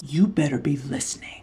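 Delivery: low mock-menacing whisper. Whispering a warning to listeners to tune in. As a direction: hiss it softly like a threat. Teasing viewers about the podcast.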